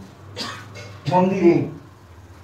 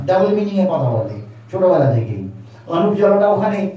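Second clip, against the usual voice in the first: usual speaking voice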